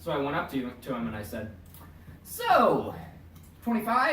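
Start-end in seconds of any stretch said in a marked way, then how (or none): 2.2-4.1 raised, teasing "So, twenty-five?"; teasing catchphrase. Retold or imitated. Friend retelling how he pestered Alex with a high, teasing catchphrase. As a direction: sing it out high to needle him. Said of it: "the thing is, I said it that many times".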